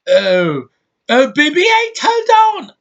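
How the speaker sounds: very high, loud British squeal; mock a British movie heroine crying out, pitch shooting way up; mock British accent. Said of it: "That accent just kills me".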